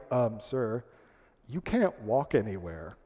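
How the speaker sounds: low, soft, careful correction; hesitant hotel clerks. Retold or imitated. Clerks letting a naive guest down, hesitant and low. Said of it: "And they're like".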